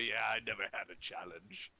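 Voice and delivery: low, quick, offhand boast; cocky. Acted. Winning dwarf to his beaten rival, cocky. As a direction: toss it off as a lightly cocky brag. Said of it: "is going to be quite cocky and say".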